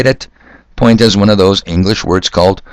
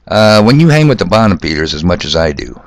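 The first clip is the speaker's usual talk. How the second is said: usual speaking voice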